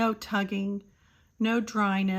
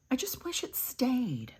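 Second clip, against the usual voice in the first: whispered wish; 4 semitones higher, 3 dB quieter than usual. Real, not acted. Wistful aside about blush.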